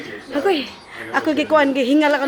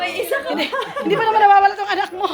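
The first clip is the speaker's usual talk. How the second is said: fast and laughing, rising a bit higher; laughing reassurance; 4 semitones higher, faster than usual. Real, not acted. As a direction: rush through it while laughing. (mabilis na pagsasalita habang tumatawa)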